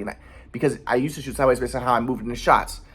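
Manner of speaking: normal talking voice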